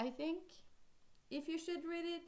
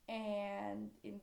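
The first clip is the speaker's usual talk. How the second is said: ordinary talk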